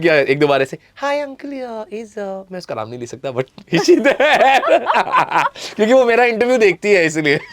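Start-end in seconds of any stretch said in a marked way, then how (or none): 1.0-2.4 put-on high sweet girl voice; man doing a woman's voice. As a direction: pretend to be a girl on the phone. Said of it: "लड़की की आवाज़ में बात करते थे".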